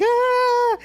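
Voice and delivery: high-pitched imitation wail; mock sobbing "aah"; mock crying wail. Climber clinging to little oxygen tank, mock crying wail. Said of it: "and, like, crying".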